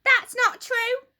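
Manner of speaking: put-on high, loud, upset old wife; Mrs Twit crying out. Old wife cries out to her husband, high and alarmed. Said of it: "cried Missus Twit".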